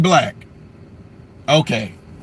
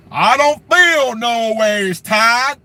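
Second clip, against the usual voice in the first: hammed-up sermon shout; mock preacher Hillary; 3 semitones higher, much slower than usual. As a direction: drag it out like a gospel preacher. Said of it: "see how she started talking".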